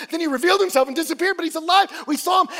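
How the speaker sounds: high, loud, hurried report; excited insistence. Acted. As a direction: rush out the news and insist it is true. Disciples insist he is alive.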